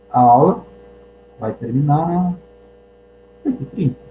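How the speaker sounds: broken into halting chunks with long pauses; unsure whether class will end then; halting, doubtful announcement of the end of class; hesitant. Shown as a demonstration. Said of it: "falou com hesitação".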